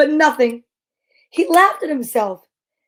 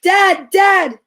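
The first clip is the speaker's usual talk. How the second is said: high, loud terrified shout; shouted in fear; 7 semitones higher, 5 dB louder than usual. Acted. Jasper in bed shouting at the carrots, terrified. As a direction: yell it out in fright.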